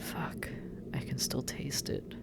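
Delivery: hushed, breathy aftershock; shaken whisper. Acted. Demon still tasting the angel's despair, shaken whisper. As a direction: drop to a near whisper, still overwhelmed.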